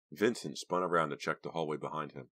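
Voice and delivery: usual speaking voice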